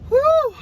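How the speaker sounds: loud high exhale after rushing in; breathless whew. Real, not acted. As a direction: blow out a big relieved whew. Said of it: "I'm out of breath".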